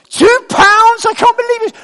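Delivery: shrieking high, loud disbelief; hammed-up scandalised neighbour; mock outrage. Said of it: "is that a question you ask people".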